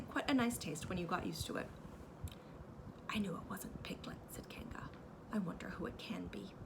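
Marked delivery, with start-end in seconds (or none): 3.1-6.5 soft conspiratorial whisper; whispered tease. A narrator's reading. Kanga teasing Christopher Robin in a whisper. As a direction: whisper it with mock wonder.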